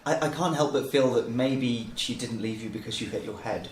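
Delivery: ordinary delivery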